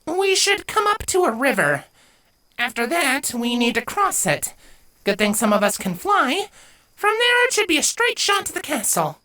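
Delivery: put-on high bright young girl voice; young filly voice. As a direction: lay out the plan, high and quick. Avia explains the route.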